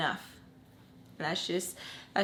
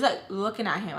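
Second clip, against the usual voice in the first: ordinary delivery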